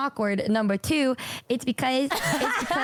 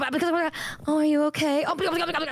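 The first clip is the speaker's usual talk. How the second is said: mock fuss; 5 semitones higher, much slower than usual. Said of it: "I'm, like, yelling at somebody".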